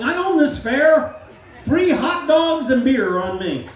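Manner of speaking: loud, slow, high boast; proud shout. Shown as a demonstration. Hypnotist shows the volunteer how to boast about the fair, loud and slow. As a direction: bellow it like you own the place. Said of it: "yell it as loud as you can".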